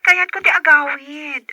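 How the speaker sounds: crying through the words, slow; tearful. Acted. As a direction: hold back tears as she says it. Daughter tearful about coming home. (agsangsangit a panagsao)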